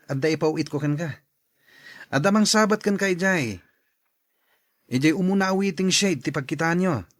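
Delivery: ordinary talk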